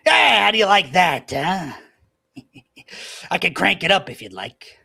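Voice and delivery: high, loud, cruel gloat with a laugh; gloating villain. Acted. Little man gloats at the collapsed David, cruel and loud.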